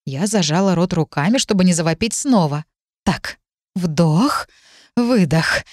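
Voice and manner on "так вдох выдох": self-calming breath count; talking oneself down between breaths; deep breathing. A narrator's reading. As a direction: pace the words on deep breaths. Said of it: "начала делать глубокие вдохи я".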